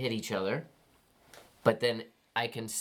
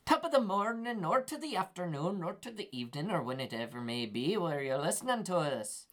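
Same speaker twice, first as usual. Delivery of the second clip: put-on Irish accent; 4 semitones higher, 4 dB quieter than usual. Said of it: "That voice you just heard".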